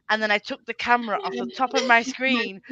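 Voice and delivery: ordinary delivery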